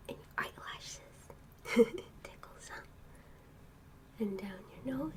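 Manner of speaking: soft hushed whisper at bedtime; pillow talk. Real, not acted. Whispering to sleepless child.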